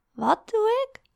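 high-pitched puzzled question; taken-aback, lifted voice; baffled. A narrator's reading. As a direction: ask in bewildered surprise. Girlfriend baffled by his outburst.

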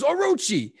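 high, sudden call of a name; mock action-hero call. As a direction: call it out like a film cop. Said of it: "the P I pops up and goes".

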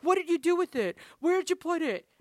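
high, loud imitation of frantic kids; demanding kids. Kids demanding their confiscated things.

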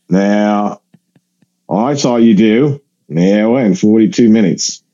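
deliberate mock Aussie with long pauses; Australian accent. Australian passenger judging the comedian's act, mock Australian accent. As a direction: deliver a blunt Aussie verdict. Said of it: "I apologize to my Australian listeners".

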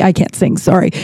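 usual speaking voice

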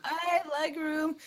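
high, drawn-out pleased quote; mock delight. Passenger recalling extra legroom on an upgrade, mock delight. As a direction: stretch it out high, savouring the discovery.

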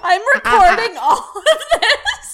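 high, loud helpless giggling; can barely talk for laughing; laughing hard. Real, not acted. Hosts cracking up mid-recording, laughing hard.

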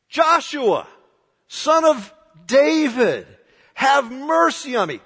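high, loud desperate yell. Acted. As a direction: shout over the crowd to be heard. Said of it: "he was yelling".

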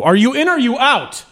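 high-pitched yell, imitating a security guard; retold guard's yell. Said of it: "guards would just yell at you".

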